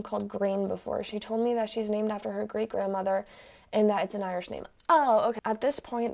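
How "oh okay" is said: usual speaking voice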